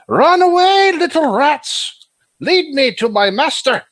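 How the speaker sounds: put-on a girl's voice gone eerie and booming; unearthly voice. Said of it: "you hear an echoing voice".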